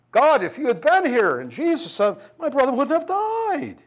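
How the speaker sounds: a grieving sister crying out at Jesus, pitched high; anguished reproach, high and loud. Acted. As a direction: throw it at him like a hurt accusation. Martha's complaint to Jesus, acted.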